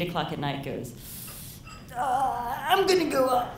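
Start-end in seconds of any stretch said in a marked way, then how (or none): 2.0-3.5 higher put-on dad voice; imitated dad. Night-owl dad leaving early for bed, mock excuse voice. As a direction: mimic a dad making his excuse, pitched up. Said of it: "And my dad".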